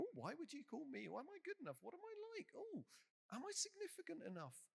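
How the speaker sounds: high, swooping self-doubting voice; hammed-up fretting over your own worth; mock insecurity. Someone looking at himself before God, mock insecurity.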